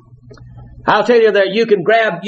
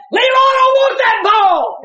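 bullhorn shout. Retold or imitated. Angry coach shouting to the fans through a bullhorn. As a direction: bark it like a mad coach on a megaphone. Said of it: "grabbed that bullhorn and shouted in the stands".

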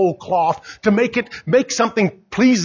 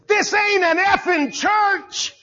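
higher, slow, shouted retort; mock angry shout; 12 semitones higher, slower than usual. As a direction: hurl it back like a rude diner. Angry man snaps back at preacher.

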